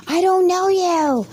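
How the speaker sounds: high panicked yell; hammed-up frightened shout; mock scream. Running around the block, the kid yells in high panic.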